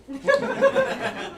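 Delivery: sudden high-pitched giggle; high laugh. Real, not acted. Comic laughing at his own geek past, high giggle. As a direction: let a squeaky laugh escape.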